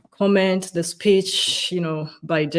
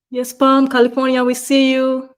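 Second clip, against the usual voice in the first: higher and louder, cheerful; excited call-out; 7 semitones higher, 6 dB louder than usual. Real, not acted. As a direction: call out to the viewer warmly. Host calls out a viewer's location.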